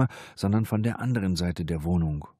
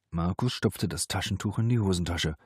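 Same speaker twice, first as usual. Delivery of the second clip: usual speaking voice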